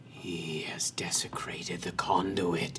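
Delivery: low, breathy whisper of many voices; eerie whispered chorus. Acted. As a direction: hiss it like a crowd of spirits. Creatures speak in chorus.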